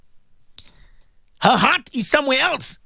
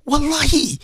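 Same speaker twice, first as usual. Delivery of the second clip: whispered oath; 4 semitones higher than usual. Real, not acted. Emphatic oath mid story.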